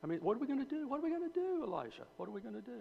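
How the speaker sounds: high, frantic "what are we gonna do"; panicked servant boy. Retold or imitated. Servant boy panicking to Elisha about the surrounding army, high and frantic. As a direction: voice shooting up in alarm as the frightened boy. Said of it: "he was in a full twit".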